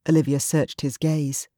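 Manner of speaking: ordinary delivery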